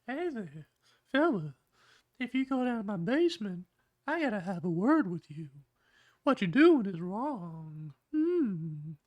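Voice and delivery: put-on very high, creepy old-man voice; creepy old Herbert. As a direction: falsetto impression of a sinister old teacher. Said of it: "while the teacher just says".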